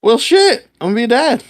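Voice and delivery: high, amazed exclamation; stunned realization. Retold or imitated. Husband retelling learning he'll be a dad, amazed.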